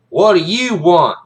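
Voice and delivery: loud, slow demand from a drill sergeant; high, slow, impatient bark; stern shout. Acted. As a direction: bellow it down at a small cadet. Sergeant snaps at crying kid.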